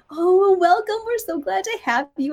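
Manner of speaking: high, welcoming singsong; kindly customs lady. Retold or imitated. Customs lady welcoming a supposed child, sweetly gushing. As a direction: greet her like a little child. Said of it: "And she's like".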